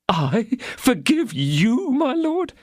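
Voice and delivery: very high, slow, disbelieving little mercer; hesitant mercer. A narrator's reading. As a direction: stretch it out in timid disbelief. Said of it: "said Bonacieux, hesitating".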